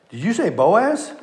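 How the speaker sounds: high, incredulous rise on the name; acted double-take at startling news; surprised question. Acting Naomi hearing the name Boaz.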